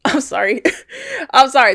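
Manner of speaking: laughter breaking out between the words; laughing apology. Real, not acted. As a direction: apologize through real giggles. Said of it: "this part actually made me laugh".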